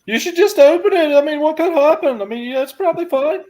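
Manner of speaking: squeaky goading voice. Acted. Teasing call from the boat.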